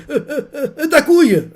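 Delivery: high stammering squeal ending loud; flustered squeak. Acted. Pervert classmate flustered by bossy Momo, high stammering squeal. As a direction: fumble the words in panic.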